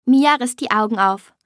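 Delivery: usual speaking voice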